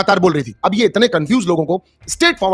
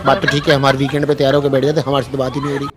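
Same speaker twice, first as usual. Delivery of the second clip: light imitation of a sulky housemate, half to herself; a hint of a pouting girl grumbling inwardly; mock Soundarya musing; 4 semitones lower than usual. Vlogger voicing a housemate's unspoken complaint, lightly mocking. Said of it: "मन में कह रही थी वो".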